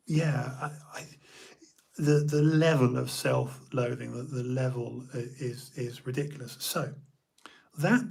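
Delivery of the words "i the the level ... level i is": ordinary talk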